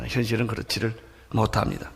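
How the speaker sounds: ordinary talk, nothing marked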